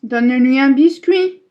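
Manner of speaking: loud call across the house. Real, not acted. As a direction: shout it to someone in another room. Calling to her mother.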